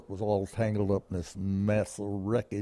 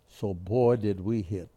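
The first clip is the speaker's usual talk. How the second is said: normal talking voice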